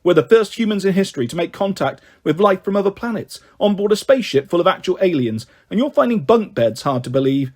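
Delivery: ordinary delivery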